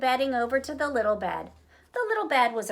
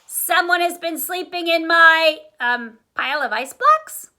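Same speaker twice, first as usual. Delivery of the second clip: growling; 6 semitones higher, 8 dB louder than usual. Acted. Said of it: "growled Papa Bear".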